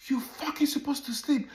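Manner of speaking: yelled reprimand, imitating the friend; imitated yelling. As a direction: act out his mad outburst. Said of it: "What do you have to yell?".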